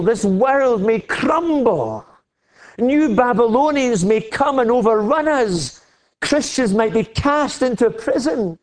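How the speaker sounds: high, ringing pulpit cry, hammering each line; full-force preaching. Acted. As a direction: preach it out, rising to a triumphant peak.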